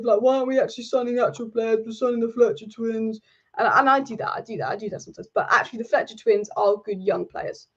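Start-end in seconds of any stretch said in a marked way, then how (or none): 0.2-3.2 grumbling fan, fed up; negative moaning. Retold or imitated. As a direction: moan about it like a disappointed fan. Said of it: "You could be all negative".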